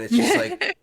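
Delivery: breaks into a high laugh mid-phrase; giggly high squeal. Real, not acted.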